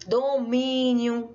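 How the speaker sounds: "domínio" slowly, with a long stressed í; dictation style. Shown as a demonstration. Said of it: "separando cada uma delas, assim, ó".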